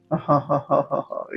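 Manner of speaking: even string of soft ha-ha-ha; light relaxed chuckle; easy laugh. Real, not acted. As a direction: laugh gently in a steady ripple. Laughs while guiding meditation.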